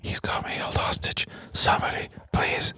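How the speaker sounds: breathless whispered plea for rescue; hushed, desperate hostage whisper; whispered cry for help. Pretend. Joking hostage plea about dog.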